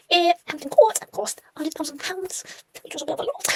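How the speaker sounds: high-pitched put-on impression; Stephen King. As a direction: raise the pitch right up and slow down for a playful impression. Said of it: "should I talk like Stephen King?".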